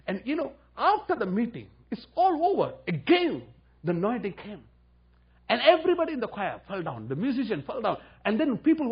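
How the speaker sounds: rising high and loud with excitement; excited preaching. Real, not acted. Preacher thrilled telling how the choir fell. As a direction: let the joy build and burst.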